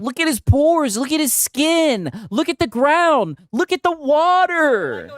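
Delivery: high, slow gushing awe; mock awe. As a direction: gush over every detail slowly. Mocking the streamer's awe.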